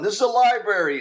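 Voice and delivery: mock outraged yell, high and loud; imitated yelling. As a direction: yell it like a scandalised library patron. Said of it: "you hear people yelling".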